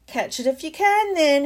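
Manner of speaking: high, loud taunt; shout. Acted. Malfoy shouts a taunt at Harry, high and loud. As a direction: fling it at him. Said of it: "he shouted".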